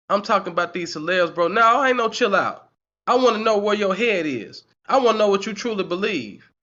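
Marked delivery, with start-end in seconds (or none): none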